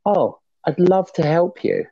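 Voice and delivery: mock-eager offer. Group owner offering help to a newcomer, mock-eager. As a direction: imitate an over-helpful offer, slowly.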